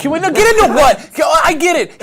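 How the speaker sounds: loud exasperated shout. Real, not acted. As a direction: yell it out in protest. Heated sports rant.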